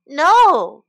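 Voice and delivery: loud cry of protest. A narrator's reading. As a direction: shout NO out in protest. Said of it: "cried the cow".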